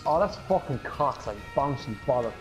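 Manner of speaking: ordinary talk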